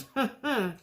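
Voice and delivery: a quick amused laugh; brief laugh at her own age; short laugh. Real, not acted. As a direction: laugh it off lightly. Laughing at turning forty.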